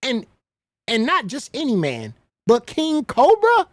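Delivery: high, loud, drawn-out disbelief; incredulous. Real, not acted. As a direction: stretch the name out in loud, appalled disbelief. Disbelief at the man suggested.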